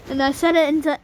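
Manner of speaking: ordinary talk, nothing marked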